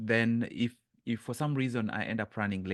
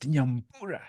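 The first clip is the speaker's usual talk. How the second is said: "Nyambura" raised with a rising end; stressed Nyambura; 4 semitones higher, 4 dB louder than usual. Shown as a demonstration. Explaining the stress to the class, raising Nyambura. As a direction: lift "Nyambura" and let it rise. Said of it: "or I emphasized".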